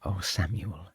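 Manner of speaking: wife melting at her husband's yes, softly; adoring. Acted. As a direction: murmur his name as if overwhelmed. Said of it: "cried Lucy".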